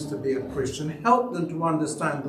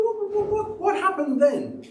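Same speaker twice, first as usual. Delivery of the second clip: high, playful what what what; feigning innocence to his wife; mock innocent protest. Husband playing innocent to disapproving wife, high and teasing.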